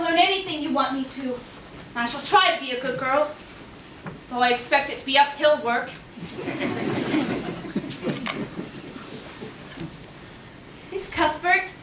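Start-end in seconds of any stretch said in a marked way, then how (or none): 5.9-9.5 soft, voiceless sigh of a laugh; a quiet rueful laugh at herself; breathy laugh. Real, not acted. After promising to be good.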